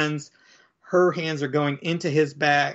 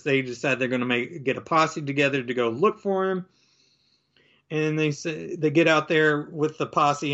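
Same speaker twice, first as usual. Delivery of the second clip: usual speaking voice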